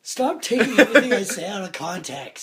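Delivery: exasperated playful shout; mock protest. Real, not acted. Protesting the out-of-context edits.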